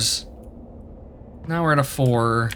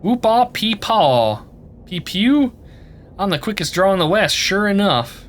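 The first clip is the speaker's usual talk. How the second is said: put-on higher whooping old-west yokel; hammed-up cowboy; 5 semitones higher than usual. Voicing cowboy Gabby bragging about his draw, hammed-up old-west twang. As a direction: holler it like a cartoon cowpoke. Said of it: "Gabby doesn't talk like that".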